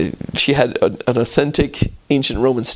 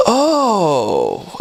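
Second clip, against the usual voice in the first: dismayed "oh"; 6 semitones higher than usual. Retold or imitated. Woman reacts to hearing the name.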